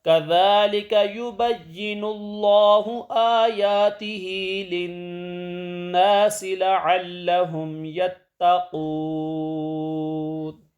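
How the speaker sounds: solemn recited verse; Quran recitation. Acted. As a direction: chant the verse slowly and carefully.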